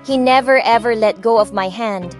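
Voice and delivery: ordinary delivery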